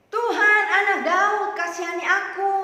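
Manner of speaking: high, very loud pleading cry; persistent shouting. Acted. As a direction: keep shouting out for mercy. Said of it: "Tapi dia terus berteriak". (terus berteriak keras memohon)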